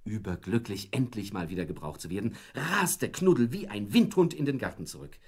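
ordinary delivery, nothing marked

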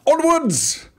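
high, loud officer's battle cry; playful officer's rallying shout; mock British officer. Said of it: "some British officer or something like that".

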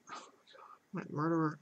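unvoiced joking whisper; mock whisper. Player whispering a joking confession. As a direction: whisper it like a guilty secret.

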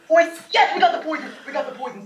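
high, laughing celebration; excited relief. Real, not acted. Player celebrating a lucky poison, laughing with relief.

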